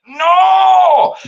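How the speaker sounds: high, loud mock-despairing no; anguished shout. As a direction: wail it like doom has arrived. Retold horror at table service.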